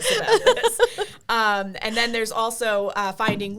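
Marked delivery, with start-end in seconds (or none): 0.0-0.8 high and loud, laughing through it. Real, not acted. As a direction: giggle out the end of the word. Jokey twist on friend's line.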